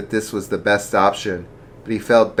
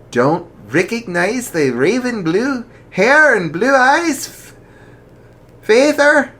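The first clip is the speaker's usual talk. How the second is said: daughter voice; 5 dB louder than usual. Acted. Daughter voiced in read story.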